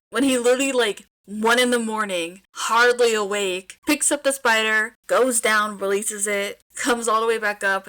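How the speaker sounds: loud, fast-building gush; excited rant. Real, not acted. Reader venting to listeners about the oblivious character, excited and loud.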